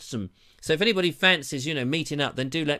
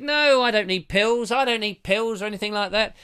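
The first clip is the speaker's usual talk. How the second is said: mock stubborn refusal; 6 semitones higher, 4 dB louder than usual. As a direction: imitate yourself waving off help, pitched up. Retold refusal of offered pills.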